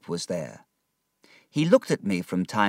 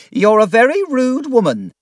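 much higher, louder outraged cry; shout; 10 dB louder than usual. Acted. Miss Murdstone shouting back at the aunt, outraged. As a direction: fling the insult at her. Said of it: "Miss Murdstone shouted".

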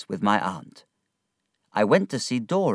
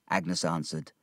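ordinary delivery

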